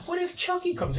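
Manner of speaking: put-on high, small worried child voice; scared kid voice. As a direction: voice the kids asking in fear. Quoting his scared kids.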